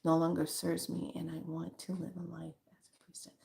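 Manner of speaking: soft whispered reading of a viewer's comment. Retold or imitated. Reading a viewer's scary confession aloud in a whisper. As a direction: whisper the viewer's worry as you read it. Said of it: "This is scary 'cause my job".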